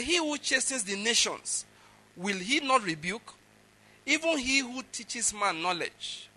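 scripture rebuke. Acted. Preacher reading the next line to the people, proclaimed.

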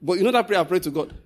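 normal talking voice

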